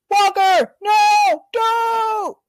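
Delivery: high, loud hammed-up yell of "don't"; mock yell. Sam and Bucky yelling at Walker to stop, mock. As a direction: shout a warning in mock panic. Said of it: "Sam and Bucket like".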